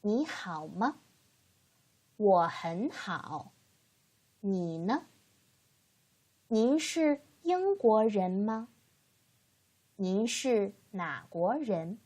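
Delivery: slow, careful Mandarin model reading; measured teacher's demonstration; read-after-me model. Shown as a demonstration. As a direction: say each phrase slowly for learners to repeat. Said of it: "You can read after me".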